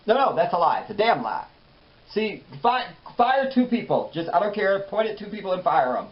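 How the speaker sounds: a pampered host snapping at crew to fire people; mock diva host. Diva host ranting at an unseen crew, loud and high.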